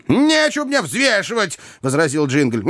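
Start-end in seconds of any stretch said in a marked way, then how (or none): 0.1-1.6 high, dismissive retort; cocky rejection; defiant refusal. A narrator's reading. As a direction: brush the advice off, pitched high. Jingle rejects the advice.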